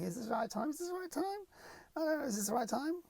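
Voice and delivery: high, soft fretful voice; mock dithering. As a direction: whimper with exaggerated indecision. Imagined endless dithering over timing.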